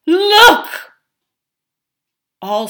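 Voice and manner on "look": high, loud, drawn-out shouts; urgent crying out. Acted. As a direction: shout in alarm, stretching each call. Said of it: "he cried".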